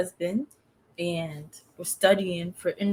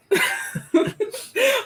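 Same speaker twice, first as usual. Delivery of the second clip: high-pitched giggling laugh; real laugh; 4 dB louder than usual. Real, not acted. Laughing after the awkward clothes aside. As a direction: burst out giggling.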